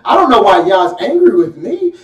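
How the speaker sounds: lightly voiced sulky innocence; mock hurt voice of someone caught; whiny excuse. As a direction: play the wronged innocent a little. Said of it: "You know how we get".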